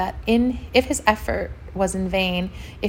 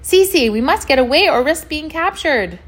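high, loud warning shout; urgent calling out; 8 semitones higher, 6 dB louder than usual. A narrator's reading. Dwayne warning Cece they must get away, calling out.

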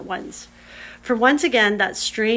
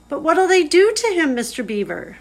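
high, loud alarmed gasp of a question; a frightened girl, higher and louder; 8 semitones higher, 8 dB louder than usual. A narrator's reading. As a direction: blurt it out in sudden fright. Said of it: "gasped Lucy".

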